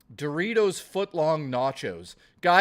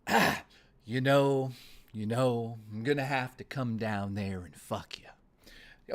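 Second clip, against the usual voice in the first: low, slow menacing Nicholson drawl; Jack Nicholson impression; 5 semitones lower than usual. Streamer to chat, doing his Jack Nicholson: low menace. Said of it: "I could do a Jack Nicholson impersonation".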